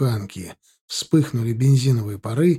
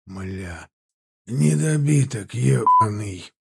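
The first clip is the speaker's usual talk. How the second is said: hoarse voice; 3 semitones lower, slower than usual. A narrator's reading. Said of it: "хрипловатый мужской голос".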